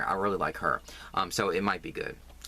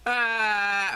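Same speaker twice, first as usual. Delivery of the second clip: much higher, louder sitcom "Ah"; hammed-up wail; comic wail; 12 semitones higher, 5 dB louder than usual. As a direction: bawl like a sitcom wife. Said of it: "I sound like Lucy".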